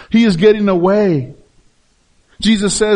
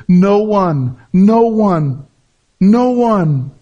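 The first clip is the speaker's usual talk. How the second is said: "No one" pressed each time, a bit louder; repeated emphasis; 2 semitones higher, 3 dB louder, much slower than usual. Shown as a demonstration. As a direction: hammer "no one" three times. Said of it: "notice the emphasis in the repeated".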